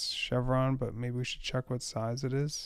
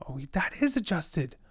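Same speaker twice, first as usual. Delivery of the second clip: usual speaking voice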